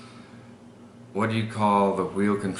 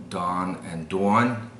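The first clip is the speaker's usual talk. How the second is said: New York "dawn and dawn", second one "dwawn"; New York accent, open first vowel, rounded gliding second; slower than usual. Shown as a demonstration. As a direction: split the two words like a New Yorker. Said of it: "So in New York".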